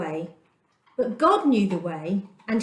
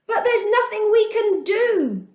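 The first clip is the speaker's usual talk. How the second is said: much higher, panicked protest; the people screaming at Moses in fear; desperate scream; 9 semitones higher than usual. A narrator's reading. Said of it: "they screamed".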